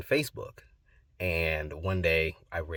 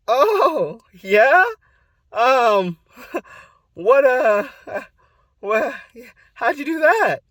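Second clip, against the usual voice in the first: very high, loud, halting mock-nervous reply; 12 dB louder, slower than usual. Nervous grandson asking grandpa about videos, high stammering. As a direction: squeak it out with awkward pauses and laugh. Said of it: "I was so nervous".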